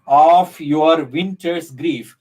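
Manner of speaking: slow, measured scansion of a line; scanned verse line. Shown as a demonstration. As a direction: stretch OF, WINTER'S and GRIEF to show the rhythm. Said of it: "What is the rhythm".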